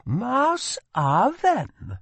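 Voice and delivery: high, slow, exasperated foreign exclamation; eye-rolling. A narrator's reading. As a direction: drag it out, fed up. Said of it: "rolling her eyes".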